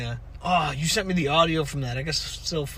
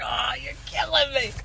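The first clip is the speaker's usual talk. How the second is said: retold amused reaction; 8 semitones higher than usual. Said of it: "I was like".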